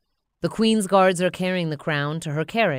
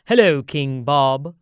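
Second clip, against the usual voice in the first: slow, louder formal advisor; royal advisor; 3 semitones lower, 8 dB louder, slower than usual. Acted. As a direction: greet the king with stately courtesy. Royal advisor greets Bob.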